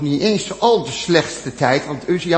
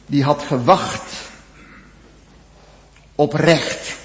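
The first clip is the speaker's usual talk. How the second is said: usual speaking voice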